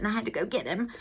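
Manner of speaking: ordinary talk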